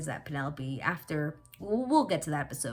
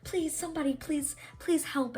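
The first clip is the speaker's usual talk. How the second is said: nervous plea; 11 semitones higher than usual. Retold or imitated. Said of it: "she's like".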